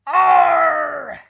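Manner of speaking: very loud, high pirate roar; captain's outraged roar at the empty barrel; pirate "arr" roar. A narrator's reading. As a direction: let out a big pirate arr. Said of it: "cried the captain".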